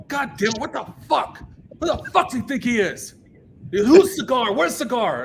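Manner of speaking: high-pitched exasperated rant, imitated; mock irate outburst, rising to a shout; Kevin Lyman. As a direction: fume and demand who's responsible.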